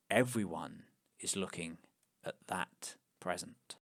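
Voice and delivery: low, soft game-master narration; quiet, halting build to a reveal; suspenseful scene setting. Acted. Game master sets tense scene.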